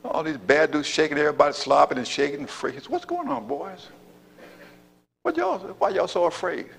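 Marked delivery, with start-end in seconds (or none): 2.9-6.7 put-on much higher, softer, easy teasing young voice; David's voice. David asking frightened Israelites why they shake, high and teasing. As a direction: tease the scared soldiers lightly. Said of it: "He see all these folks shaking".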